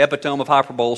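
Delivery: quickly, "epitome" as EP-i-tome, "hyperbole" as HY-per-bowl; fast, "epitome" rhyming with home, "hyperbole" ending in bowl; comic mispronunciation. Retold or imitated. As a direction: misread "epitome" and "hyperbole" as spelt, final e silent. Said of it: "would say that kind of thing".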